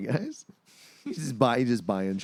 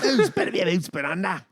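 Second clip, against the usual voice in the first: put-on Aussie accent; 8 semitones higher than usual. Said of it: "totally Aussie now".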